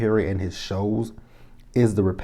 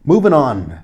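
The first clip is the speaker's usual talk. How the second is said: loud, higher-pitched announcement; emphatic moving on; 5 semitones higher, 8 dB louder than usual. Real, not acted. Recapper cutting off her rant about Hannah, emphatic. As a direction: call it out to shut the topic down.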